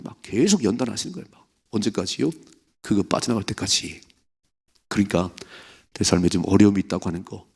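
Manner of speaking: usual speaking voice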